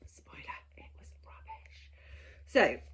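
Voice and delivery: slow, soft whispered aside; conspiratorial whisper. Real, not acted. To viewers, admitting the game prompts failed, slow whisper. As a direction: lean in and whisper the confession.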